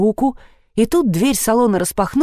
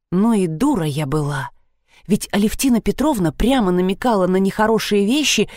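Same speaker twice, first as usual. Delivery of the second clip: ordinary delivery, nothing marked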